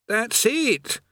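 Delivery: weary prisoner griping; haggard chained man. A narrator's reading.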